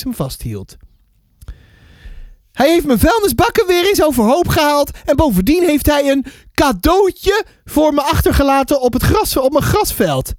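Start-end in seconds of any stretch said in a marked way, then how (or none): 2.5-10.3 indignant neighbour, high sing-song voice; mevrouw Banks. Acted. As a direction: complain loftily, pitched well up. Neighbour complains about the dog. (hoge, verontwaardigde buurvrouwstem)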